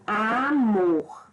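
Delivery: "amor" drawn out, weight on the final MOR; stress on MOR. Shown as a demonstration. Teacher showing the stressed syllable, "amor" with force on MOR. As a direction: say "amor" slowly, leaning on mor. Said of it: "pronuncio com mais força".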